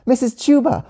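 put-on high-pitched kid shout; high, eager call; shouting for attention. A narrator's reading. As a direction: call the teacher excitedly, up high. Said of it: "shouted Cammy".

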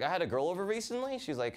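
usual speaking voice

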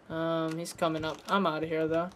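ordinary talk

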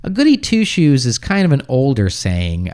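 ordinary talk

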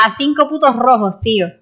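excited. Real, not acted. Repeats amazement.

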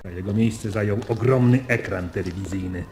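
normal talking voice

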